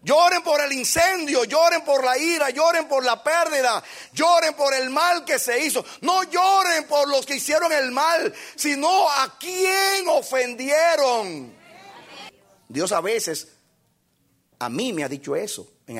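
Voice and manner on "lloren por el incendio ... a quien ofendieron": shouted, high sermon climax; rally the church with every line; fiery preacher hammering each repeated lloren; preaching at full force. Acted. Preacher shouting his main point.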